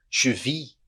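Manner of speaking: "Je vis" ending on vee; "Je vis" as zhuh vee; silent S. Shown as a demonstration. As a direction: drop the S of "Je vis". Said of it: "não fala o S".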